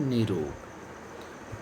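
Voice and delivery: निरोग drawn out very slowly and softly; slow stressed nirog. Shown as a demonstration. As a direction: stretch निरोग out, syllable by syllable. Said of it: "निरोग होता है". (निरोग बहुत धीरे, खींचकर)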